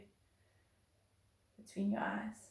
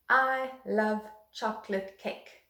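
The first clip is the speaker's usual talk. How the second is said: slow, even demo of the sentence; slow example sentence; slower than usual. Shown as a demonstration. Teacher introducing the rhythm sentence to young pupils, slow and even. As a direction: say it slowly so pupils can catch the rhythm. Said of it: "I'm gonna use the one".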